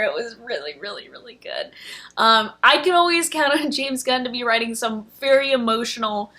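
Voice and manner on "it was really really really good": choked-up whisper. Real, not acted. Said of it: "getting emotional".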